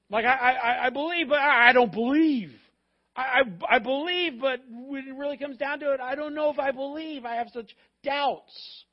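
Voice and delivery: loud, high, stammering outburst; agonized doubt. Acted. Voicing a believer's agonized doubts.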